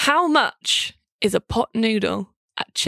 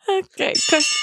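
usual speaking voice

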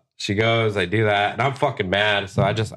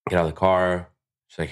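normal talking voice

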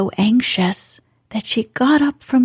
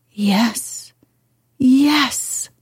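almost whispered; 4 semitones higher than usual. Acted. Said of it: "he almost whispered his answer".

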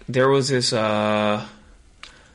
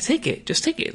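high, wheedling voice pressing a gift on him; mock pushy woman insisting sweetly; pushy hotel lady. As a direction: coax him into taking it, voice pitched up.

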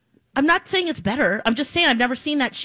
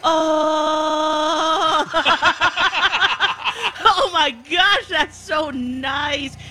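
slow pleasured groans under the massage gun; 3 semitones higher, much slower than usual. Real, not acted. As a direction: melt into the massage, sighing the words out slowly. Massage gun on her shoulder.

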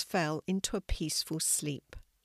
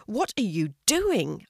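annoyed; 3 semitones higher, 5 dB louder than usual. Acted. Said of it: "feeling annoyed".

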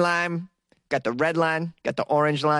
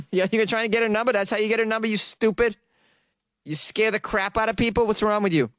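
fast, higher-pitched incredulous telling-off; mock scolding; 5 semitones higher, faster than usual. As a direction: lay into him as if he were an idiot.

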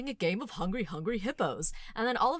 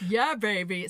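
loud, groovy catchphrase; louder Austin Powers voice; Austin Powers impression; 9 dB louder than usual. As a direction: channel the swinging sixties spy. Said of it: "my John Oliver impression veers into Austin Powers territory".

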